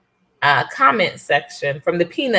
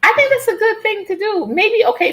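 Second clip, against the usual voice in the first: higher, sing-song insisting; playful high voice. Real, not acted. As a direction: chirp it out playfully. Playfully insisting on new rule.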